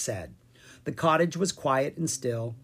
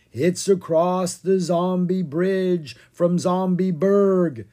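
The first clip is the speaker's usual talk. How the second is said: slow, loud, higher zombie drone; 5 semitones higher, 6 dB louder, slower than usual. A narrator's reading. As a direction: drone it like the undead. Ella keeps zombie act.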